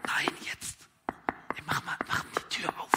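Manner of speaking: urgent hushed pleading; insistent whisper. Acted. Friend at the door insisting to be let in, urgent whisper. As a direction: whisper it, won't take no.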